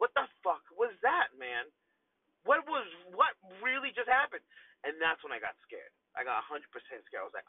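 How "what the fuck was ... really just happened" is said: alarmed disbelief. Retold or imitated.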